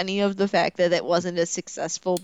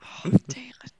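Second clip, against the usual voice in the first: unvoiced dismayed whisper; 4 semitones higher, 8 dB quieter, slower than usual. Real, not acted. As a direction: whisper it in mock despair. Mock dismay at embarrassing role.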